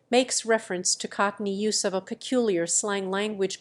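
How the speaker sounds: ordinary talk, nothing marked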